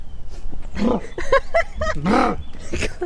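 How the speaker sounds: breathless disgusted laugh. Real, not acted. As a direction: laugh helplessly at the smell. Laughing at the stench.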